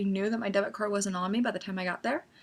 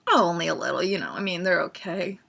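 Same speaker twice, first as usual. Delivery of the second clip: lower, offhand downplaying; feigned nonchalance; 4 semitones lower than usual. Fan asked if she likes them a lot, feigned nonchalance. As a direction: drop the pitch and act unimpressed.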